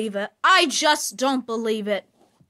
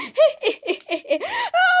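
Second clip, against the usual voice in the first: high, far-off evil cackle of a killer doll; 14 semitones higher than usual. Acted. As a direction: cackle like a possessed toy, high and gleeful. Chucky leaves laughing.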